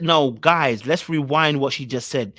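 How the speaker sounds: ordinary delivery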